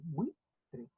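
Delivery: "buitre" in two separate quiet beats; syllable by syllable. Shown as a demonstration. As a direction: softly split "buitre" as bui-tre. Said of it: "Buitre se separa así". ("buitre" suave, separado en bui-tre)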